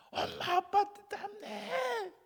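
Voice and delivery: put-on very high, slow child squeal; whining child. Child complaining to mother about dad's sweat, high whine. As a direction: squeal it in disgust, drawn out.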